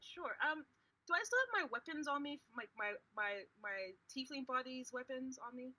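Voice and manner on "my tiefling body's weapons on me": ordinary delivery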